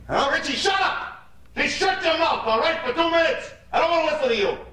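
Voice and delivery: high-pitched furious shout; angry yell. Acted. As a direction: bark it at an unruly sidekick. Yells at crony to shut up.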